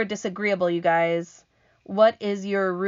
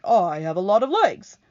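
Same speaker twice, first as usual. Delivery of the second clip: proud centipede; 7 dB louder than usual. Acted. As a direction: show off like a vain centipede. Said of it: "the centipede answered proudly".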